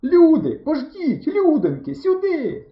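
high, loud desperate cry for help; frantic high-pitched yell; desperate shouting. A narrator's reading. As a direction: scream after people who are leaving. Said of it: "І отчайдушно закричали".

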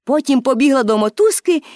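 plain reading voice

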